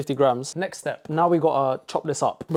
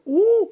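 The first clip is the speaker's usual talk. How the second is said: sustained high-pitched coo; delighted high "ooh"; 5 dB louder than usual. Real, not acted. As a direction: react to a lovely smell with a high "ooh". Reacting to a nice smell.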